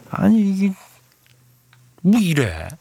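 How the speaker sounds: high, exasperated burst of disbelief; real indignation at the company; indignant outburst. Real, not acted. Radio host fuming on a listener's behalf, indignant and high. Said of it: "좀 화가 났어요".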